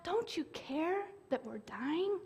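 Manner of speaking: begging, wounded; hushed anguish; soft desperate plea. Retold or imitated. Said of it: "Listen to 'em".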